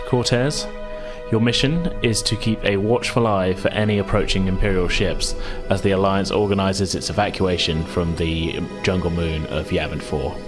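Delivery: usual speaking voice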